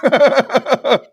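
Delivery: real chuckle carried into the words; laughing. Real, not acted. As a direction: laugh while making the point. Laughing at his own point.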